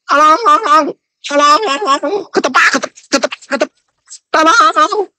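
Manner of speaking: high, shrill-pitched mock pleading of a trapped thief; mocking cry for help. Preacher mocking debaters as a thief crying for help, high mock wailing. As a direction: ham up a thief tied to a tree begging. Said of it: "kalian hanya bisa teriak".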